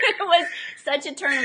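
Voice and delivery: words spoken through a laugh. Real, not acted. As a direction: still laughing as you say it. Laughing after husband's quote.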